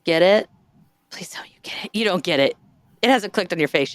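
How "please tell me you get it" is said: whispered plea, very soft; pleading whisper. Real, not acted. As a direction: whisper it as a hopeful aside. Pleading for the joke to land.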